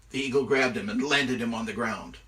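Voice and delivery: ordinary delivery, nothing marked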